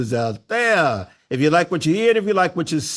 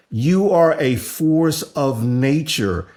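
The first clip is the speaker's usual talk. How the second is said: ordinary talk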